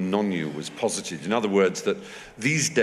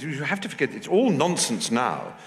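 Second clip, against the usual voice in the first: Duchess of Devonshire; 6 semitones higher than usual. Retold or imitated. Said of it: "She said".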